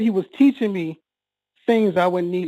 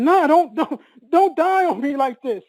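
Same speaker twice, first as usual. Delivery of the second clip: higher-pitched pleading, stumbling on the first words; retold desperate plea; 6 semitones higher than usual. Son holding his dying father's head, retold desperate plea. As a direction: re-enact begging someone to hold on.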